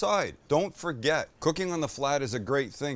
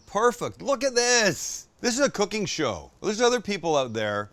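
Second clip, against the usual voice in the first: higher, emphatic, a bit indignant; excited outburst; 7 semitones higher, 3 dB louder than usual. Real, not acted. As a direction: sell it like a proud host defending his show. Mock outrage at rival cooking shows.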